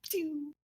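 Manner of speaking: high-pitched playful "pew"; little high vocal sound effect; imitated throwing sound. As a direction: mimic a dagger flying off.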